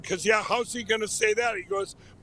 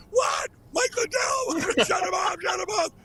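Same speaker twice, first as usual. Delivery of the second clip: high-pitched mock panicked shout; hammed-up alarmed outburst, laughing partway; the Murdochs; 10 semitones higher than usual. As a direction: imitate outraged bosses yelling, pitched way up. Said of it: "the Murdochs would be going".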